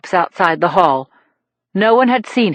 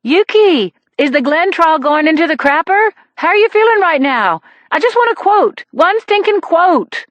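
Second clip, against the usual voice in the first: reporter calling after her; 8 semitones higher than usual. A narrator's reading. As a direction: chase her down with a quote demand. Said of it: "The reporter called after her".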